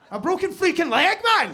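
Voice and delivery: high, loud outburst of disbelief; incredulous shout. Acted. Incredulous shout about broken leg.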